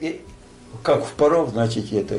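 ordinary talk, nothing marked

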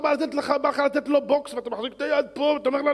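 loud, high, worked-up retelling; mock angry outburst. Preacher acting out a man wanting to punch, loud mock anger.

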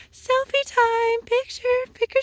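high, slow sing-song call; excited. Real, not acted. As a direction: announce it like a treat. Excited selfie with horses.

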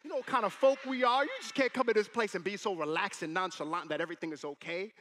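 loud, high-pitched indignant outburst; freaking out. Retold or imitated. Father scolding the relaxed doctor, freaking out. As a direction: burst out in mock outrage, then ease slightly. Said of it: "freaking out".

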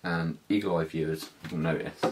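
usual speaking voice

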